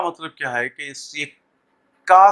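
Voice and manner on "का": "का" said as a distinct k sound; k sound demo. Shown as a demonstration. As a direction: sound out "का" for the learner. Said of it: "sound को represent कर रहा".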